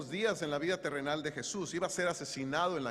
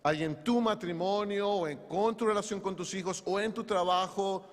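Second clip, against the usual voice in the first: normal talking voice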